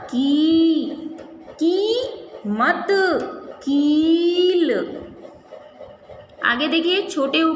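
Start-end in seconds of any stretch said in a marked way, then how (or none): 0.0-4.7 drawn-out teacher demo; slow syllable demo. Shown as a demonstration. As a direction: stretch each syllable of की and कील out very slowly. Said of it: "क में बड़ी ई की मात्रा".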